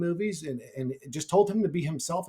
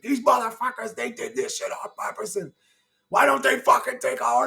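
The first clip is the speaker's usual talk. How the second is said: put-on high-pitched accented grumbling as angry father; cursing Greek dad; 7 semitones higher than usual. Dad cursing in the truck going home, high accented rant. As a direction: imitate dad's indignant accented cursing. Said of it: "he's cursing".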